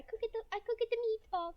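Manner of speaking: high-pitched mock Italian; Italian accent. Shown as a demonstration. Streamer hunts for the voice, high mock Italian accent. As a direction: try out a comic Italian accent. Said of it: "There it is, there's the voice".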